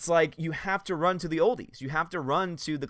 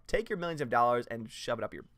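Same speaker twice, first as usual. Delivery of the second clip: normal talking voice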